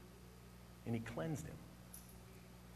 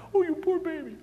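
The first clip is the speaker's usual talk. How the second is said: put-on much higher, cooing baby-talk sympathy; gushing tender pity, pitched way up; 10 semitones higher than usual. Shown as a demonstration. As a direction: coo over a hurt child. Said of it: "when I'm compassionate".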